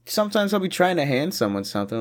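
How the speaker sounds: normal talking voice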